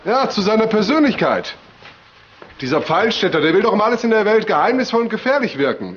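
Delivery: mock the man's wish to seem dangerous; scornful mockery. Inspector mocking the missing man to his team, scornful.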